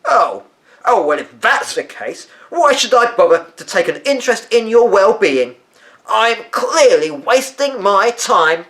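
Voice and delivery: loud, high, huffy sarcasm, slowing at the end; a haughty grandmother taking offence; offended grandmother. A narrator's reading. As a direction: drip with wounded sarcasm.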